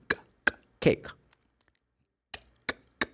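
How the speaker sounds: bare voiceless "k" leading into cake; sound of K. Shown as a demonstration. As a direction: pop out "k" without any vowel. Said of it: "the sound of letter K is like this".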